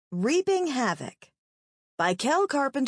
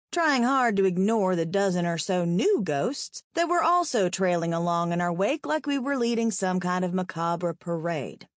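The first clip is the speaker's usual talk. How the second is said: ordinary delivery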